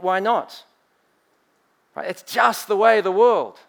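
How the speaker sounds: mock worldly voice. Mocking the worldly view.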